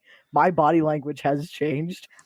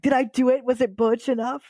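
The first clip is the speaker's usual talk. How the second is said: higher, unsure campy question; unsure camp voice; 8 semitones higher than usual. Retold or imitated. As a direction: ask as if seeking approval. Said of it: "And it's like".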